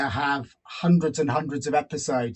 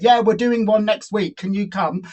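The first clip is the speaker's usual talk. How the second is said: retold eager reply; 7 semitones higher, 7 dB louder than usual. Retold producer's keen reply.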